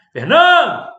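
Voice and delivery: loud, high shout of a name; gritão. Shown as a demonstration. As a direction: call out FERNANDO at full voice, stressed syllable stretched. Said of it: "dá um gritão". (gritão bem forte e agudo)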